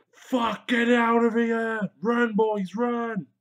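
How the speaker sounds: high, loud panicked yell; panicked shout. Pretend. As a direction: scream for the boys to run. Mock panic at marine attack.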